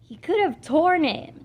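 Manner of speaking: sulking about her sweater, whining; whine. A narrator's reading. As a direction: whine it out slowly. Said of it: "Jessie whined".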